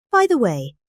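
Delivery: ordinary delivery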